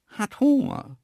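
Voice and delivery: high-pitched animal interpreter; Isabella. Acted. Antelope translating for the hungry beast, high voice. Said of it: "übersetzte Isabella".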